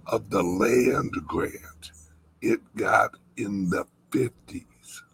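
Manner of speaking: usual speaking voice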